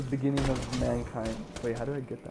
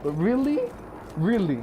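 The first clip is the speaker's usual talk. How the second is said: incredulous; 9 semitones higher, 4 dB louder than usual. Real, not acted. Incredulous at bad news.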